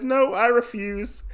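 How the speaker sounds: high-pitched acted refusal; retold defiant refusal. Usagi refusing the duel, high defiant voice.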